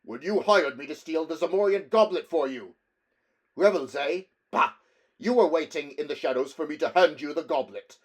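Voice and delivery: furious accusation. Acted. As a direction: accuse him hotly. Barbarian angrily accuses nobleman.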